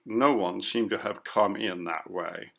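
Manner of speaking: usual speaking voice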